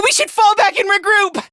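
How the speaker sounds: high, stammered call to retreat; alarmed urging. Acted. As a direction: urge the team back, pitched high. Urges a retreat.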